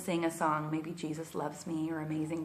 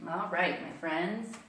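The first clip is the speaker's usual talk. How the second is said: normal talking voice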